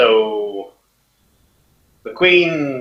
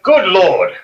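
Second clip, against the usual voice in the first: higher, louder shocked cry; startled exclamation; 9 semitones higher, 7 dB louder than usual. Acted. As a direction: gasp it out in shock. General startled by the transforming dragon.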